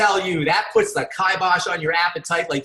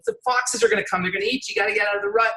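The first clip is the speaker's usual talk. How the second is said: higher-pitched, pleading joke voice; urgent warning; 5 semitones higher, faster than usual. Retold or imitated. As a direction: play worried little animals warning their friend. Animals warn frog again in joke.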